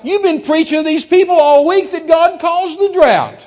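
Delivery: high, loud tirade; angry accusation. Retold or imitated. Guest berating preachers about their week's preaching, angry shout. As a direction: accuse at the top of your voice.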